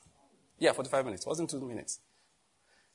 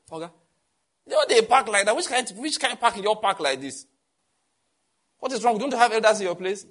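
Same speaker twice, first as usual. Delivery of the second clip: higher, louder scolding of a rude youth; scolding elder; 6 semitones higher, 5 dB louder than usual. Retold or imitated. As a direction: scold him like an offended elder. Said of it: "If a young person comes talk to you rudely".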